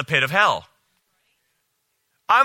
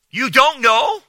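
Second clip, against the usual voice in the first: high, loud disbelieving echo; shocked that a pastor doesn't know; incredulous; 8 semitones higher, 5 dB louder than usual. Real, not acted. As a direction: throw his words back in disbelief.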